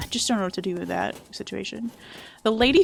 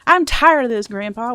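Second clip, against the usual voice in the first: a hint of a whining kid; lightly acted kid quoting the movie; mildly voiced kid line; 8 dB louder than usual. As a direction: quote the kid with a little lift. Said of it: "reference point for the".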